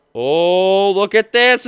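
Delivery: usual speaking voice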